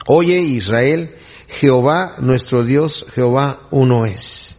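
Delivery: slow, weighty, each phrase drawn out; slow solemn recitation. Shown as a demonstration. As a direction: recite it slowly like a sacred creed. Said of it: "Este es el Shema, que todo judío repite diariamente". (recitación solemne y lenta)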